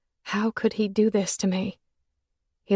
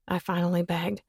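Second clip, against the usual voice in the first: usual speaking voice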